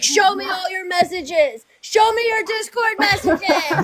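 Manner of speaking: high, slow mock yell; imitating angry shouting. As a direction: yell it like a jealous boyfriend. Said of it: "He's like".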